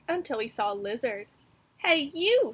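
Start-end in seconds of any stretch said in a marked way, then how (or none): 1.8-2.5 loud, higher shout-out; bossy call. Acted. Mouse hailing the lizard, loud and cocky. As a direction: call across to a stranger, cocky. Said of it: "said the mouse".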